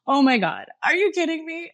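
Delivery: high, loud gasped astonishment; stunned, high-pitched quote of herself; tearful disbelief. Retold or imitated. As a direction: retell being overwhelmed, voice leaping up. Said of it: "crying at the table".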